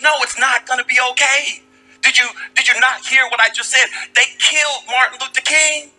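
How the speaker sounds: high-pitched, imitated upset protest; distraught rebuke. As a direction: act out grief turning on the listener. Distraught maid rebukes the consoling child.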